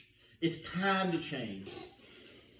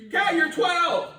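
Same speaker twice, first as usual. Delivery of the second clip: God scoffing; 5 semitones higher, 4 dB louder than usual. Retold or imitated. God teasing him about his age.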